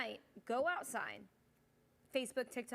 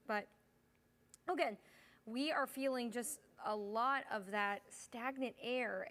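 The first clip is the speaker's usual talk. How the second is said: ordinary talk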